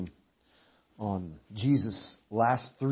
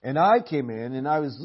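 ordinary talk

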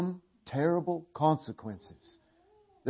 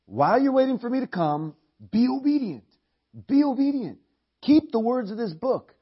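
much higher and louder, pleading; urgent exhortation; 9 semitones higher, 5 dB louder than usual. Acted. Preacher telling the church to obey while waiting, urgent. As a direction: drive the command home.

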